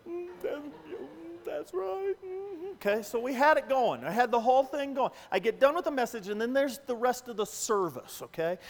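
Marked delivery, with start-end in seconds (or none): 0.1-2.7 teary grandma. Retold or imitated. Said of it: "grandmas were".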